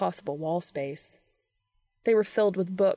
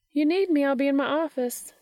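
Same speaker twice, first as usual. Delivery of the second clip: higher, louder voice calling over her shoulder; a brisk colleague's parting call, pitched up and loud; breezy Missouri; 7 semitones higher, 4 dB louder than usual. Acted. Missouri calling to the men as she leaves, higher and louder.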